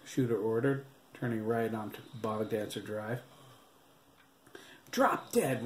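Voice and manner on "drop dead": high, defiant cry; angry cry. A narrator's reading. Handcuffed boy defying the cop, high angry cry. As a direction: fling it back at the cop. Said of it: "Ricardo cried".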